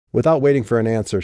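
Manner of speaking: ordinary delivery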